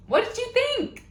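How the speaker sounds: ordinary talk, nothing marked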